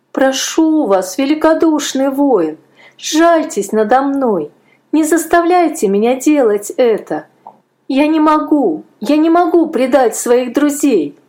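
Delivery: put-on a boy begging for mercy; pleading boy. A narrator's reading.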